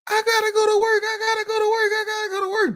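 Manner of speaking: high whiny mock sob, repeating; high mock-crying plea to go to work; mock crying whine. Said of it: "She crying instead of hollering".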